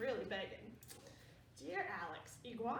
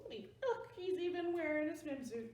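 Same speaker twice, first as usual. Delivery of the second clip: ordinary delivery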